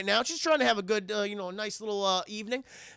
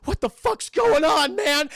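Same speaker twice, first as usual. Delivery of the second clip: high, loud, slow outcry; hammed-up alarmed question; acted alarm; 6 semitones higher, 7 dB louder, slower than usual. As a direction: cry out in mock disbelief. Acted injured, alarmed teacher.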